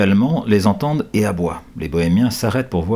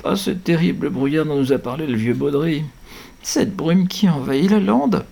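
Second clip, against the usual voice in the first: higher, pleading voice of dread; horrified moan; 8 semitones higher than usual. A narrator's reading. Sister moans as the fog rolls in: horrified moan. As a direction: moan it with fear. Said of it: "gémit sa sœur, horrifiée".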